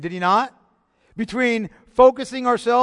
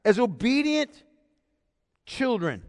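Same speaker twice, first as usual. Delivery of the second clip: obedient raised high and held; stress on obedient; 4 semitones higher than usual. Shown as a demonstration. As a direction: lift obedient, drop children. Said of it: "The emphasis is on".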